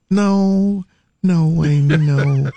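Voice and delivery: high, drawn-out refusals stepping down; wife declining. Acted. Acts wife declining the offer.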